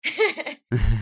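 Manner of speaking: real laugh bursting out; big amused laugh; loud burst of laughter. Real, not acted. Laughs at being taken for Tunisian.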